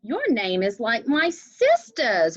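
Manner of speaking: a slow, lightly voiced boy; a touch of a child speaking in class; Rigoberto's line. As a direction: read the boy's words brightly. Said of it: "Rigoberto says".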